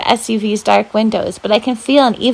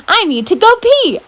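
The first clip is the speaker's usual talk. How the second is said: pee face voice; 12 semitones higher than usual. Acted. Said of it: "Mac thinks I'm making".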